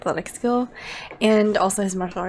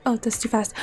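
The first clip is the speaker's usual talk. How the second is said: usual speaking voice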